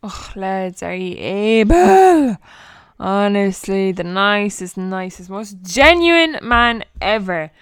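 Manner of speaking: loud, drawn-out gushing praise; excited. Real, not acted. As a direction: gush loudly to your listeners. Gushing to listeners after the interview.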